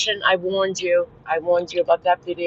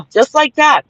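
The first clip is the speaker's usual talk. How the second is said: indignant; 8 semitones higher, 6 dB louder than usual. Real, not acted. Angry recounting.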